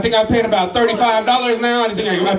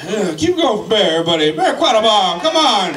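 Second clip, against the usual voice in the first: ordinary talk, nothing marked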